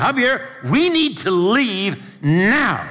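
high, loud and slow, every word pressed; desperate demand. Retold or imitated. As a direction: insist on leaving now. Shaking friend, demanding to leave.

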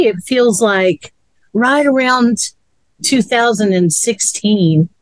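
normal talking voice

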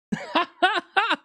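high cackling laugh; high delighted cackle; gleeful laugh. Real, not acted. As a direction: burst out laughing in triumph. Laughing after taunt.

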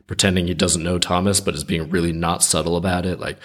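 ordinary talk, nothing marked

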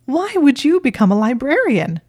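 high, loud disbelief; incredulous, high-pitched questioning; bewildered. A narrator's reading. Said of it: "in a bewildered voice".

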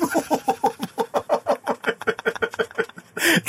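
wheezing high-pitched laugh. Real, not acted. Laughing hard at the comment to his viewers, high squeal.

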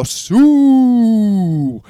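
long, loud, high celebration yell; Cristiano Ronaldo. Shown as a demonstration. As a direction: belt out one long triumphant whoop. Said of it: "stretch your arms out and go".

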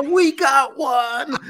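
high, loud, slow cry with a laugh; excited movie shout. Retold or imitated. Quoting the Ghostbusters scene, laughing excited shout. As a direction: belt it out like the Ghostbusters alarm. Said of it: "hits the buzzer".

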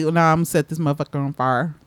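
quick, low, casual country accent, "fire" as "far". Shown as a demonstration. Host mimics her own casual talk, quick country accent. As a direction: toss it off in a Texas country accent. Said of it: "you're being very country right now".

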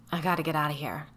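ordinary delivery

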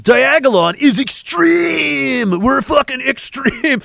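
high, stretched-out hype shout; over-the-top meme announcer yell; mock extreme-sports hype. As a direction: draw out "extreme" like an X Games promo.

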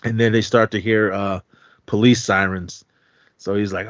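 normal talking voice

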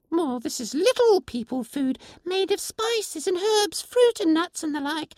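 tiny creature asking in wonder; pixie voice. Acted. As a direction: pixie asking the stranger if rain melts her. Pixie explains fairy food.

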